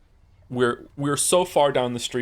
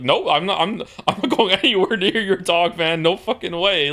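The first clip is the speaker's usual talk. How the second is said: loud, high retold refusal; mock emphatic refusal; 7 semitones higher, 5 dB louder than usual. Refusing to go near the other man's dog, loud mock refusal. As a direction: refuse in exaggerated disbelief.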